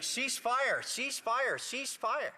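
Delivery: high-pitched mock yell, half-hearted on volume; retold scream. Grandfather yelling at soldiers shooting bottles, high mock yell. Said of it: "started screaming".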